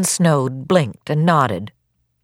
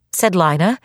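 usual speaking voice

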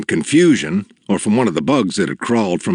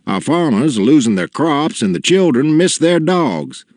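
higher-pitched, insistent plea; raised, earnest story-character voice; father confronting a giant; 7 semitones higher than usual. A narrator's reading. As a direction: press the complaint to the giant.

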